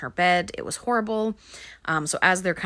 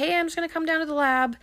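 casual cover story; 11 semitones higher, 3 dB louder, faster than usual. Retold or imitated. Sebastian casually covering his sneaking.

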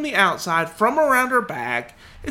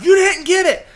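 high, loud accusing yell; outraged cry; 9 semitones higher, 6 dB louder than usual. Retold or imitated. Wife accusing husband of missing the bug, outraged cry.

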